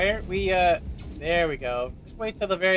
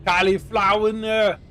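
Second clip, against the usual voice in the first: mock British accent; 3 semitones higher, 4 dB louder than usual. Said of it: "how it was pronounced with my accent".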